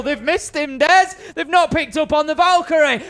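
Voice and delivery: alarmed call. Real, not acted. Caster spots a big mistake.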